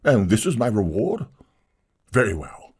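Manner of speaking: outraged, high-pitched tragedian; wounded pride, thrown at her; storming. Acted. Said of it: "it stormed".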